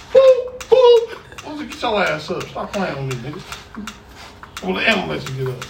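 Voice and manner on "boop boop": much higher, louder squeaky boop boop; mock smack noises. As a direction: squeal the smacks as cartoon sound effects. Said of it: "So my mama smacks me".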